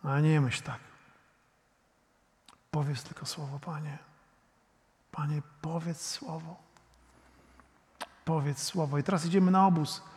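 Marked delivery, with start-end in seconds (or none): 2.7-9.0 hushed, softer plea to God; quiet prayer. Acted. Preacher quietly pleading with the Lord for his daughter. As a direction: pray it under your breath, humbly.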